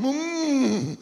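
high, drawn-out mock moan; imitated moan. Preacher imitates the moan he dreaded in church, high soft moan. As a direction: moan softly on a long rising hum. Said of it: "I'd hear the moan".

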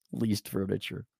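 ordinary talk

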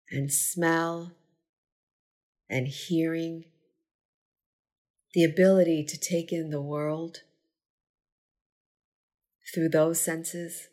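unhurried meditation guide, long pauses between phrases; measured, spacious gratitude-practice delivery; guided meditation. Acted. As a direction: lead a body scan, giving each phrase space to settle. Leads a gratitude body scan.

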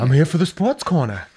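a hint of a dumb jock; meathead voice. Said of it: "the meathead voice".

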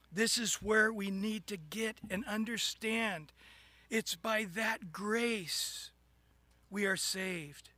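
ordinary delivery

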